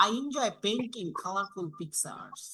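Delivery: slow, with the voice falling at the end on picture; falling intonation. Shown as a demonstration. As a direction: read it slowly and let it drop. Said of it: "এটা একটা statement down". (ধীরে, শেষে picture-এ গলা নামানো)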